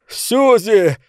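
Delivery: high-pitched shouted name; cried out. A narrator's reading. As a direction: cry the name out, pitch leaping up. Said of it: "выкрикнул он". (высокий выкрик имени)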